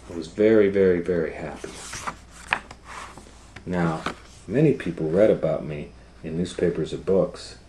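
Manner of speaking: loud, proud storybook read; reading to children. Acted. Teacher reads about Ruby's fame to the class, loud and proud. Reads picture book aloud.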